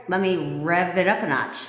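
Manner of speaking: ordinary talk, nothing marked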